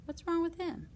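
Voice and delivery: high, bright mock alarm; a playful gasp of fake worry; mock surprise. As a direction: pretend to be shocked by the picture. Mock alarm at tractor picture.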